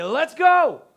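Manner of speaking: loud, high rallying shout; shepherd's shout to gather the flock; calling out. Acted. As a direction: call out big and high. Shepherd calling his sheep.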